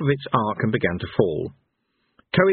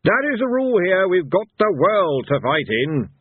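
high, raised-voice ruling; shouting; 9 semitones higher, 3 dB louder than usual. A narrator's reading. Blind Io lays down the rule to the fighters, shouting. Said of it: "shouted Blind Io".

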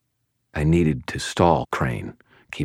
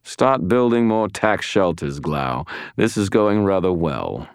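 higher, self-satisfied villain; smiling smugly; 5 semitones higher than usual. A narrator's reading. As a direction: gloat sweetly through a smile. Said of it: "smiling like butter wouldn't melt".

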